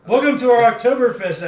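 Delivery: big hype host intro; announcer. Acted.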